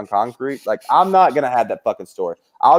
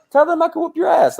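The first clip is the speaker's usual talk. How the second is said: guy from high school. Retold or imitated. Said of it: "And he's like".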